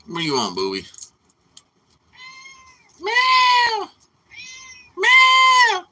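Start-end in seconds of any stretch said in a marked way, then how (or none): 3.0-3.9 very high, loud meow; hammed-up cat yowl. Imitating the screaming cat. 5.0-5.9 very high, loud meow; hammed-up cat yowl. Imitating the screaming cat.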